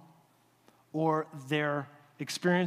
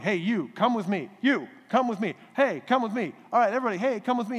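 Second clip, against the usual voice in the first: louder and higher, hailing people to follow; calling out; 6 semitones higher, 6 dB louder than usual. Retold or imitated. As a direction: pastor playing Jesus gathering his crew, calling out loud and high. Pastor voices Jesus calling followers.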